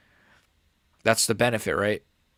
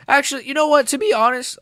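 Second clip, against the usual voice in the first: real squeaky crack, much higher and louder; 14 semitones higher, 5 dB louder than usual. Real, not acted. Streamer rethinking his verdict on the fight, voice cracking high. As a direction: voice keeps jumping up out of control. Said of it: "my voice keeps cracking".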